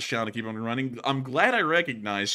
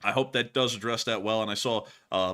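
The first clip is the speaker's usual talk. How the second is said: ordinary talk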